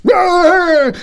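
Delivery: very high, loud yelled roar. Acted. McX roaring through the door at the rat. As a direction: bellow at the rat behind the door.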